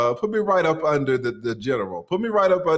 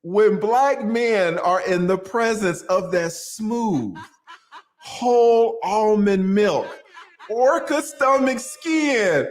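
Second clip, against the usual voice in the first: slow dramatic reading; 6 semitones higher, 3 dB louder, much slower than usual. Pretend.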